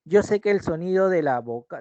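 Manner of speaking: ordinary talk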